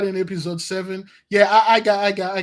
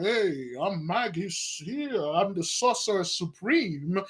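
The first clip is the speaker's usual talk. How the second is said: soft, slow, lightly mocking grand title; mock pompous magus; 3 semitones lower, 8 dB quieter, slower than usual. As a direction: toss off the grand title with scorn.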